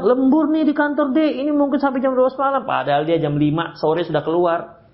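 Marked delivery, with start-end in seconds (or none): 0.0-2.7 high, fast excuse of a husband; lying husband. Retold or imitated. Husband lying to his wife about overtime, high and fast. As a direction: rattle off the fib like a caught liar.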